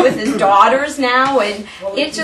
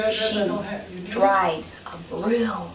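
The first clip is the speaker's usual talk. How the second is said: softer, slow, drawn-out tease; sly confiding; 2 semitones lower, 7 dB quieter, much slower than usual. Retold or imitated. Police tour guide teasing kids about their teacher, confiding.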